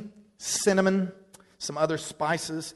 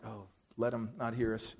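low, hushed plea; nervous aside; 6 semitones lower, 12 dB quieter than usual. Retold or imitated. Reluctant leader at the party door hoping not to be heard, low and hushed. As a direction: keep it well down, almost to yourself.

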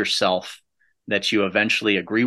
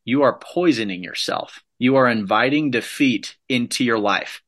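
ordinary talk, nothing marked

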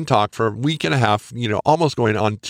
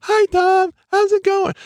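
very high, louder mock falsetto; 4 dB louder, slower than usual. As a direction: mimic a high squeaky boy to tease him. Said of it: "and they're like".

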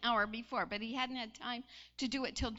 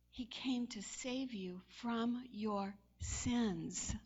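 slow and solemn; much slower than usual. Acted. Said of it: "slow solemn preaching line".

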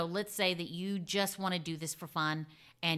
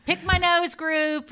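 laughing; 10 semitones higher, 10 dB louder, slower than usual. Real, not acted. Laughing joke about absurd groups.

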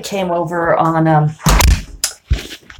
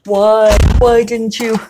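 higher mock whine; hammed-up whiny complaint; whining; 4 semitones higher than usual. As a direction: whine the complaint like a sulky kid. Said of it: "I was whining about it".

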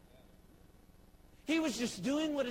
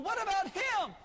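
higher, eager and a bit louder; eager question; 10 semitones higher, 4 dB louder than usual. Retold or imitated. Preacher as Samuel asking hopefully, higher and louder. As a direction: Samuel hopefully points at the next son. Samuel asks about each son.

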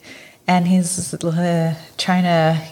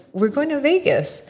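much higher, matter-of-fact mock announcement; imitating him; 9 semitones higher than usual. Retelling the date, she mimics him announcing the Vegas trip. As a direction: toss it off like it's obvious. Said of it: "and it's like".

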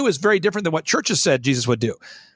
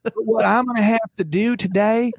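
lightly put-on, higher churchy voice, half laughing; mock Christianese pastor; 3 semitones higher than usual. Guest mimics a pastor softening bad news, mildly mocking churchy voice. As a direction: imitate a pastor hedging politely, a touch higher. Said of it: "But people will say".

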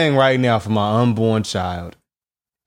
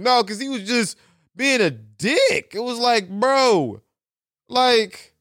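higher-pitched, exasperated complaining; mad whiny rant; 7 semitones higher than usual. Real, not acted. Vlogger ranting to viewers about a rude shopper, whiny and mad. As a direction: vent like you can't believe this guy. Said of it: "I know I sound mad whiny".

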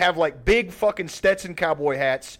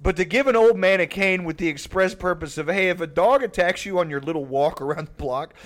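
ordinary talk, nothing marked

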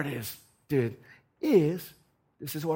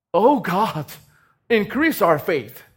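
loud, slowed cry to God; pleading prayer; 4 semitones higher, 9 dB louder, slower than usual. Acted. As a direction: beg God loudly for more faith.

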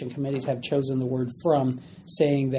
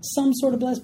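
higher-pitched, mildly desperate begging; desperate pleading; 6 semitones higher than usual. Acted. Esau begging his father for something left, desperate. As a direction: plead with Dad for any scrap. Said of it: "hear the desperation in his voice".

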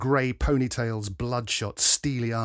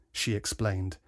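ordinary delivery, nothing marked

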